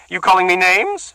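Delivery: high, loud accusing woman; suspicious woman. Acted. Bertha accuses the muttering man, high and loud. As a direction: bristle with suspicion.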